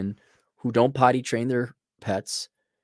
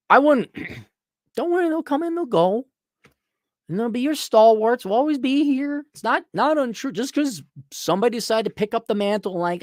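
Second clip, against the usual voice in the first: higher, animated reassurance; raised excited pitch; 13 semitones higher than usual. Real, not acted. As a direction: lift the voice up high. Long stretch in raised voice.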